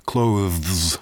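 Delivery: Clothes said with a buzzing voiced th then z. Shown as a demonstration. He demonstrates Clothes for his friends, voiced th into z. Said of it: "the vocalized T H, so it's a the, followed by a z".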